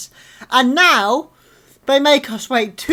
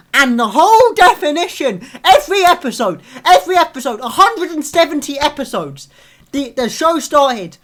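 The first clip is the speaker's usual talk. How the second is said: angry rant; 4 semitones higher, 6 dB louder than usual. Real, not acted. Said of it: "I'm, I'm so angry".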